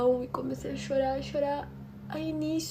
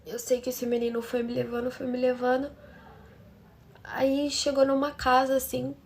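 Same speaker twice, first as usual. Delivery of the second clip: ordinary talk, nothing marked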